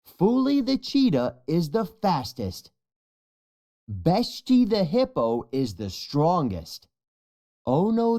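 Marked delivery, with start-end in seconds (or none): none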